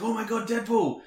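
high, gushing fan squeal; excited gushing. Retold or imitated. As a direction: gush like a hyped fan. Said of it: "are posting on their Facebook".

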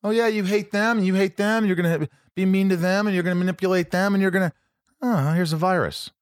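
high-pitched sarcastic scolding; fed-up God. Acted. Imagined God punishing humanity, acted exasperated scolding. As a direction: pile up the accusations, pitched high.

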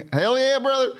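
high, laughing mock hype "Hell yeah, brother"; playful imitation; wrestler hype. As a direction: copy the wrestler catchphrase. Echoes wrestler bit laughing.

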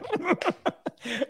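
high nervous giggle; nervous laugh. Real, not acted. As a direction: laugh out of nerves. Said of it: "me río de nervios".

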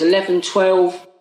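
ordinary talk, nothing marked

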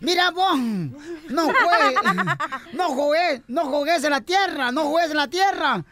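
high, repeated mock scolding; scolding mom. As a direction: nag the kid like a typical yelling mother. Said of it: "lo empieza a regañar".

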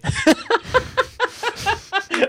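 long, high-pitched giggling fit; real laughter. Real, not acted. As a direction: dissolve into helpless laughter. Reacts to remark on impression.

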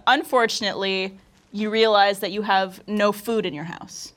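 put-on American accent. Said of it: "this is my American accent".

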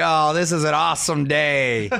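normal talking voice

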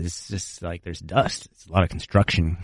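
low, hushed excuse while choked up; emotional. Real, not acted. Blaming dust for the tears, quietly emotional. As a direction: drop soft and low, covering real emotion. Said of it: "I didn't know that you were this emotional".

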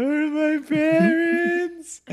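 mock sobbing child voice, high and drawn out; imitating crying kid. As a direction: whimper it like a little lost boy. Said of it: "I started crying".

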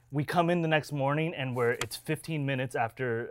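normal talking voice

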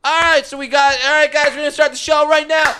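high-pitched student improv host; eager improv kid. Acted. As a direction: hype up a passing crowd like an amateur troupe. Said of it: "it's just a bunch of kids going".

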